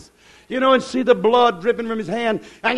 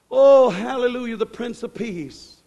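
ordinary talk

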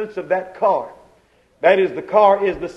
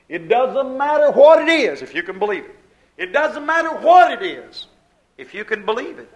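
ordinary delivery

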